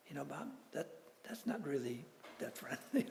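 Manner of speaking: soft, hesitant imitated reproof; gentle reproof. Friend gently objects to a military joke, soft mock reproof. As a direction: drop the volume and correct a friend gently.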